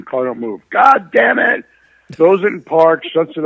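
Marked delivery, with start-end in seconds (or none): 0.7-1.6 mock outburst, pitch jumping up, louder; retold angry curse. Dad cursing at the stuck car, mock angry shout. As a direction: bark it like the frustrated dad.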